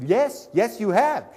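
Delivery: high, loud exasperated confirmation; emphatic insistence. Retold or imitated. Guest answers front desk about the open door, emphatic. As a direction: hammer it home, worked up.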